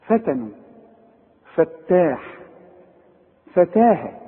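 فتاح with thin, fronted "ae" vowels; light vowel after each ف, as in فتنوا; correct light Fa. Shown as a demonstration. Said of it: "اقراها صح".

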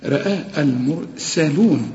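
المرسلون with a thin light S, slowly. Shown as a demonstration. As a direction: recite المرسلون keeping the S light. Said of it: "لكن السين بقى رقاء".

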